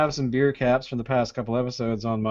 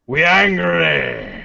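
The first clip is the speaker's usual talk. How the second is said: drawn out, slow, hammed-up angry; slow mock-furious beer name; mock angry growl; much slower than usual. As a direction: stretch it out like a cartoon grump. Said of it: "A we angry Scotch Ale!".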